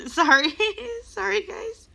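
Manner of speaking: high, choked-up apology; tearful. Real, not acted. Apologizing to viewers for crying, tearful and high. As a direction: apologize through tears. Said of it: "sorry, I'm about to cry".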